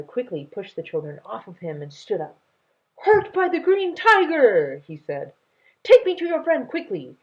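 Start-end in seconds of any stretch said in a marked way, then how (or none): 3.0-4.8 alarmed. Acted. Grandfather alarmed. 5.8-7.2 urgent grandfather. Acted.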